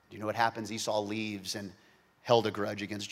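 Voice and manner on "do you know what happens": ordinary talk, nothing marked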